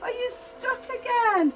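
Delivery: bright, sing-song greetings to each toy, gasping with delight; children's entertainer voice, big and eager; excited kids' presenter. Acted. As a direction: wow the little ones as you greet every guest. Kids' Christmas show presenter.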